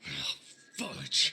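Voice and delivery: frustrated mild curse, punched out; exasperated. Acted. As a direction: blurt it out, exasperated. Frustrated at receptionist's stalling.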